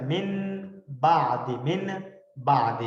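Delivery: usual speaking voice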